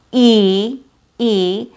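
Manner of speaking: E held long and full; a drawn-out, isolated E; long E sound. Shown as a demonstration. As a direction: sustain a long ee for "E". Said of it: "which has a long E sound".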